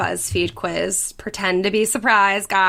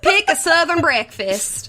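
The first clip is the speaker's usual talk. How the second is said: put-on higher-pitched Southern belle accent; 6 semitones higher than usual. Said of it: "do this in solely Southern accents".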